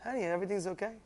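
quiet soothing spouse; controlled calm. Acted. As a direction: say it gently, well under normal volume. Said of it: "you are in control, and you just say".

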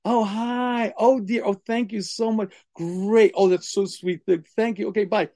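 high, sugary polite phone talk; sweet phone voice. Retold or imitated. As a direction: gush to the caller. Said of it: "you ever hear the couple".

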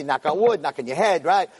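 normal talking voice

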